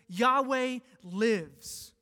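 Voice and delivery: loud, very slow proclamation; preaching at full force; emphatic declaration. Real, not acted.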